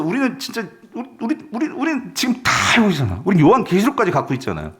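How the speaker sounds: usual speaking voice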